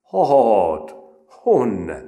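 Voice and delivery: "Hát, honne" in broad rustic village dialect; peasant dialect. Retold or imitated. Recruit answering in broad peasant dialect. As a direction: say "honne" with broad country vowels. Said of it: "a leggarázdább népszínmű kiejtéssel".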